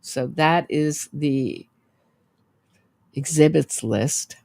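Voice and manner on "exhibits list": ordinary talk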